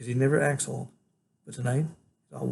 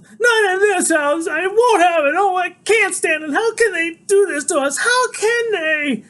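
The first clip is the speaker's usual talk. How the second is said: sobbing, shrieking very high and loud; grandma screaming in despair, slow; crying shout; 8 dB louder, slower than usual. Acted. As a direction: wail it out, breaking with tears. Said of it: "her voice is big and full of tears".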